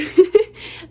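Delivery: loud relieved laugh through tears; teary laugh. Real, not acted. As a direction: burst out laughing, still teary. Laughing after crying.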